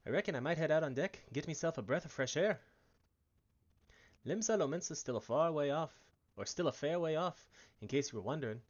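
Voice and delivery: put-on a loose Irish accent, easy and chatty; peddler's Irish lilt, half held. Brennan telling the new adventurer about Limsa Lominsa, in a light Irish accent. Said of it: "I think it's Irish".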